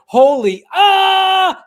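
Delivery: loud exclamation breaking into a high yell; yell-covered censored quote. Retold or imitated. Host mimicking the censored TV edit, loud covering yell. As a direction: drown the swear word in a mock scream. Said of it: "covered it with his yell".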